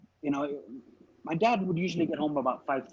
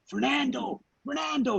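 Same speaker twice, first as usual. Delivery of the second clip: high-pitched repeated name call; mock holler, each name pitched high; insistent calling; 9 semitones higher than usual. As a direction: call after someone running off, acted. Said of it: "I kept telling you".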